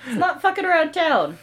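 high, laughing denial; laughing protest. Real, not acted. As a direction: deny it through laughter.

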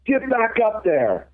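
high, drawn-out call at an even volume; mock yell, pitched well up and stretched slow; retold coach's yell. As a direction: imitate a coach hollering across the field, without real force. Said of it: "And he yells".

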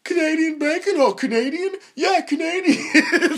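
high, exaggerated intrigued questioning; mock delighted interest. Dad hearing of the bacon, hammed-up high excitement. Dad's reaction to Canadian bacon.

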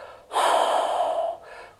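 forceful whoosh exhale. Shown as a demonstration. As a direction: blow the air out hard, whoosh. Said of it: "blow air out forcefully through your mouth, like".

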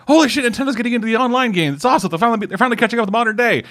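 high, fast, hyped voice; excited fan. Retold or imitated. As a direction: gush like a thrilled gamer. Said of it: "everyone was like".

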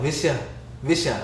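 "Visha" as a quick blurred two-syllable word; mock slurred version of Vince Young; imitating misheard name. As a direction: repeat "Visha" evenly, as one foreign-sounding name. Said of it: "I thought you were saying".

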